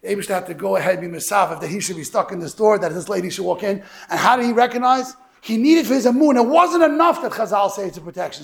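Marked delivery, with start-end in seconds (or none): 4.1-8.4 louder, higher sermon climb; preaching build. Acted. Rabbi building to the lesson for listeners, rising preaching. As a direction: drive the point home to the congregation.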